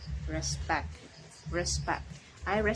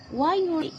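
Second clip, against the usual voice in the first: Why you? pitched high and a bit louder; rising question; 10 semitones higher, 5 dB louder than usual. Shown as a demonstration. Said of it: "teacher models question intonation".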